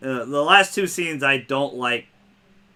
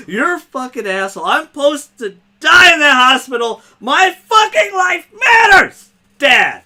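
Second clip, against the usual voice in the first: overplayed angry shout; 12 semitones higher, 8 dB louder than usual. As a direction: ham up Ellie's outburst far stronger than the original, climbing to a full shout. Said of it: "She doesn't say it as strong as that".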